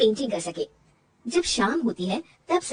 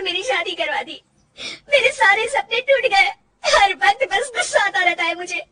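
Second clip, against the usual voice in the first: much higher, crying and shouting through tears; sobbing outburst; 9 semitones higher, 4 dB louder than usual. Acted. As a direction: pour out her broken dreams while weeping. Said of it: "Rajni फूट फूट कर रोने लगती है". (फूट-फूटकर रोते हुए दिल की बात)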